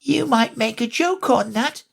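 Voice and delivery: high tiny insect voice; a very small voice right at her ear. A narrator's reading. Tiny gnat voice teasing Alice at her ear. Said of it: "said the little voice close to her ear".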